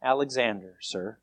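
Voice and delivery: low, soft, shrinking reply; sheepish little murmur to a superior. A narrator's reading. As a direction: answer meekly, fading away on the last word. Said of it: "in a very sheepish voice".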